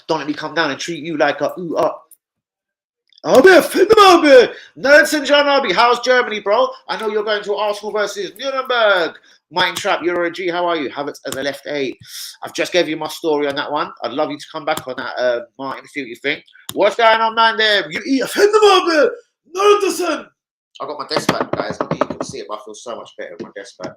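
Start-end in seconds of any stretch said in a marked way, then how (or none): 3.2-9.2 excited shout-out. Real, not acted. Hyped shout-out to a viewer. 16.7-20.3 much higher, much louder, slow hyped greeting; excited shout-out. Real, not acted.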